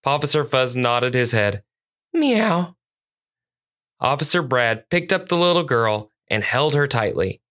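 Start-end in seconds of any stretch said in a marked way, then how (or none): 2.1-2.8 high-pitched spoken meow; cat meow. Acted. Cat officer confirming the find, high meow.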